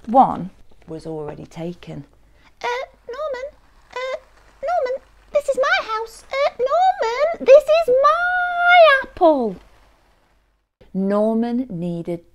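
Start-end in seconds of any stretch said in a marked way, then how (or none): 2.6-9.5 very high little voice, building louder; tiny creature protesting. Acted. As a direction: squeak an indignant claim, stretching the end. Apple's occupant objects to Norman.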